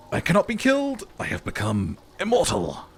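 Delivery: triumphant shout. Acted. Player gloats after dodging bullets.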